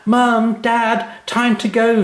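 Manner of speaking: put-on very high, loud child calling out; a girl calling through the house at dawn; excited call. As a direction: call the parents, bursting to leave. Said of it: "she called".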